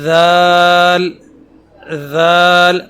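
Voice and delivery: دال slow and loud but plain; simple soft dal. Shown as a demonstration. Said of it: "بہت simple ادا کیا جائے گا". (سادہ اور نرم دال)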